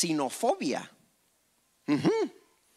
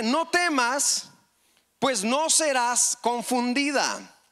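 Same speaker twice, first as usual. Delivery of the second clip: scripture reading; 6 semitones higher than usual. Acted. Preacher reads the verse.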